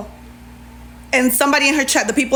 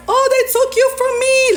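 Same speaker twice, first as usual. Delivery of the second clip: high mock whine, much higher; mock whiny trolling; 13 semitones higher than usual. Teasing Max about being taken, high mock whine. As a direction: whimper it in a put-on high voice, teasing. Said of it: "Like, I was trolling".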